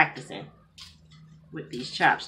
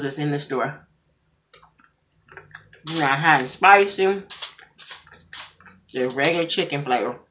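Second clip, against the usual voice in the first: chewing while talking; talking between bites; mouth full of noodles; 2 semitones lower, 4 dB louder than usual. Real, not acted. Seen 4: Noodles put in mouth about 5.3s, chewing through 7.5s.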